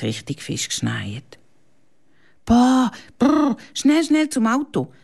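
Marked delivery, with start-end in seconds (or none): 2.4-4.9 higher, louder cold shiver; shivering boy. Acted. Lukas rushing to the car in the snow, higher shivering voice. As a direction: shiver and hurry everyone along. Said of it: "meint der Lukas".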